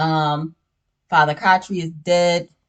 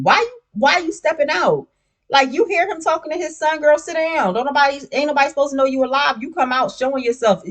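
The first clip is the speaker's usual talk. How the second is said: exasperated scolding; 8 semitones higher than usual. Real, not acted. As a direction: tell her off like a fed-up friend. Scolding a character on screen.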